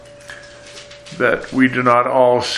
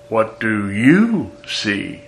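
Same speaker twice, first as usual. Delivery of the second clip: slow "What do you see?" with YOU stressed; much slower than usual. Shown as a demonstration. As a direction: drag it out, leaning on "you". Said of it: "Or it could be".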